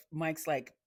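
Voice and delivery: usual speaking voice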